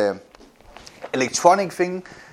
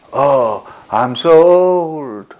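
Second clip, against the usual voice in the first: slow, lower, louder mock moan; mock self-pity; 6 semitones lower, 6 dB louder, much slower than usual. Preacher mocking how we lie to ourselves, slow mock moan. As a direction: groan it out like a sorry old soul. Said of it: "we lie to ourselves".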